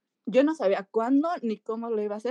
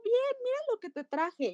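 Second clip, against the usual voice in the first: imita al niño con voz mucho más aguda; 13 semitones higher, 4 dB quieter than usual. Retold or imitated. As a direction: ofrece el regalo como un niño, con voz muy aguda. Voz del niño con regalo. (el niño le enseña su regalo, voz infantil aguda)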